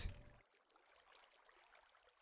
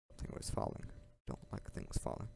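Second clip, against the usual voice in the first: soft unvoiced whisper; 2 semitones lower, 12 dB quieter, faster than usual. Real, not acted. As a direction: whisper it to yourself. Unvoiced muttering while playing.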